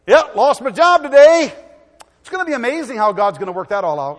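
loud, high, joyful praise for bad news; a hammed-up happy believer; cheerful praise. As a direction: rejoice loudly that the car broke down. Believer praising through trouble.

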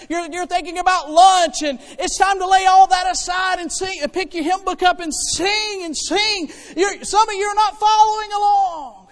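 ordinary talk, nothing marked

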